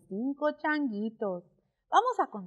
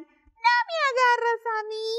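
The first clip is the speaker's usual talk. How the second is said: teasing monkey voice; 11 semitones higher, 5 dB louder than usual. Acted. Monkeys taunting the crocodile, Spanish.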